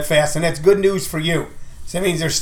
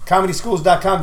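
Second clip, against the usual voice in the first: ordinary talk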